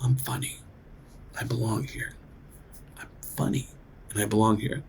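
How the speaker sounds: very soft, half-whispered self-talk; muttered mantra. Retold or imitated. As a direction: murmur it to yourself before going on. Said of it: "I went".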